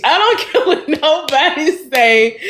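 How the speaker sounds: talking through real laughter; can't keep the laugh out of it. Real, not acted. Praising her friend Bria to viewers, laughing through words.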